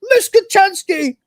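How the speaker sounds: high, loud hysterical outburst; hammed-up frantic cry; freaking out. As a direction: blurt it out in mock hysteria. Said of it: "he's still freaking out".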